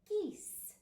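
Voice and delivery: geese said clearly for the class; geese, stretched and clear for children; stressed word. Shown as a demonstration. Teacher models geese for the children, clearly. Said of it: "Let's try".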